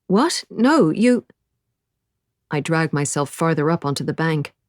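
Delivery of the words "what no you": high, louder startled objection; taken aback, pitch jumping up; baffled protest. A narrator's reading. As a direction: blurt it out in disbelief. Rescuer baffled by man's denial.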